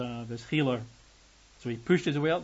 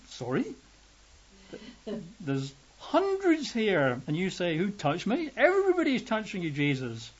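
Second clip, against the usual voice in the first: incredulous disciples; 6 semitones higher than usual. Acted. Disciples mock Jesus's question.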